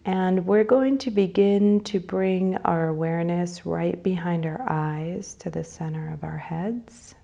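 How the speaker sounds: unhurried phrases, leaving space to breathe; guided meditation. Real, not acted. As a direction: lead them gently into breathing and imagery.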